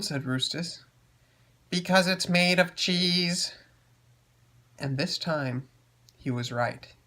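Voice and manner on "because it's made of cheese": robot voice. Acted. Tiny robot answers.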